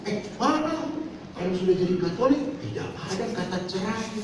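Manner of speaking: very high incredulous rebuke; hammed-up shocked pastor; scandalised priest. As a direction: cut her off in mock shock. Priest rebuts divorce idea.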